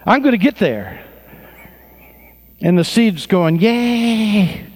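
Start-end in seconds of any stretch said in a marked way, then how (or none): 0.0-1.0 high, pressed resolve; squeaky seed that won't quit; determined seed. Acted. Preacher voicing a little seed, high and determined. Seed voiced in sermon parable. 3.6-4.6 high little cheer; cheering seed. Acted. Preacher's seed character cheering, high.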